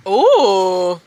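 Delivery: usual speaking voice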